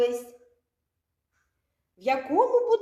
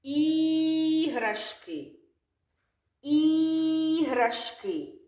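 Іграшки slowly, with the І held long and higher; stretched first sound; 4 semitones higher, much slower than usual. Shown as a demonstration. Teacher stretching the word for children. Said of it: "звук І".

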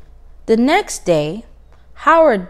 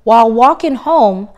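plain reading voice

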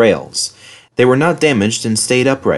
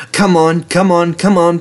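urging, higher-pitched repeated calls; puffing engine; 8 semitones higher, 3 dB louder than usual. A narrator's reading. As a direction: chug out each urgent call like Edward puffing. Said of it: "puffed Edward".

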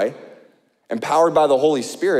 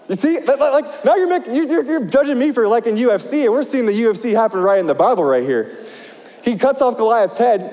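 excited; 6 semitones higher than usual. Real, not acted. Defends loving UFC to congregation.

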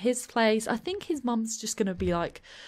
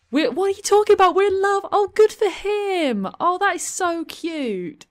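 high, loud squeal of delight; delighted gushing; 10 semitones higher, 7 dB louder than usual. Real, not acted. Player thrilled that he declared their love, high excited gushing. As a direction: gush at full pitch, stretching the last words. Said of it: "I'm actually really pleased about that".

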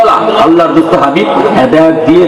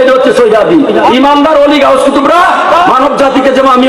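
fervent preaching; 8 semitones higher than usual. Real, not acted. Preacher exhorting listeners about calling to the Prophet, fervent high preaching. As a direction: preach it hard to the gathering.

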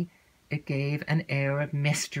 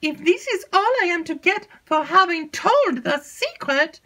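put-on high, breathless chatter of an excitable young maid; alarmed lady's maid spilling gossip; 4 dB louder than usual. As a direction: gabble it like a nervous servant girl. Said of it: "cried Annette".

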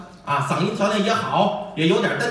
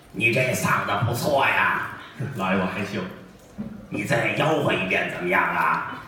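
put-on old official; 10 semitones lower than usual. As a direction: speak like a self-important old official. Official voice continues.

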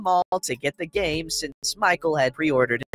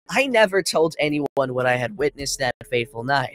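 ordinary delivery

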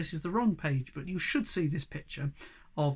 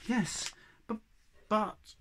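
higher, hesitant, mildly sad; small, halting reluctant reply; 6 semitones higher, much slower than usual. Acted. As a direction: falter on it sadly.

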